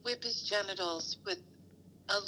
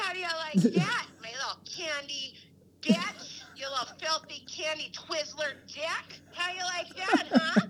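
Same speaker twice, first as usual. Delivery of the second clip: shrill scolding mom, much higher and louder; hammed-up angry mom yelling; 6 semitones higher, 6 dB louder than usual. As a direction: berate him like a domineering mother. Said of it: "while I was doing my mom voice".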